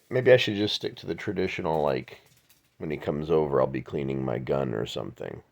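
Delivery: normal talking voice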